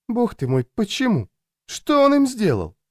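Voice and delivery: plaintive questions climbing very high; dismayed. A narrator's reading. Louisa asks why they beat her bodyguard, dismayed. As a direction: start subdued, rise into a high lament.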